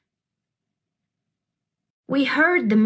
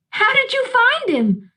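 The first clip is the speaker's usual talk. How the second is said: much higher, excited; eager question; 13 semitones higher than usual. Acted. Girl asking the old women, eager and high. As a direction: ask it bursting with curiosity.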